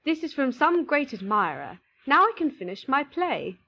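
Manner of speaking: a touch of joyful relief; happy playwright. A narrator's reading. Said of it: "he looked quite happy".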